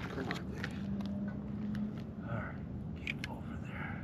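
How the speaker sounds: hushed whisper urging the fish along; whispered coaxing. Real, not acted. Angler whispering to a fish under the ice, hushed. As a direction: breathe it out so the fish isn't spooked.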